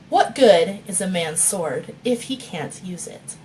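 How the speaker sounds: ordinary talk, nothing marked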